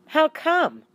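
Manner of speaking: high, loud "how come"; puzzled boy's question. A narrator's reading. A J asks Garth why he has come, high and loud. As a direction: blurt it out, baffled. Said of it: "A J asked".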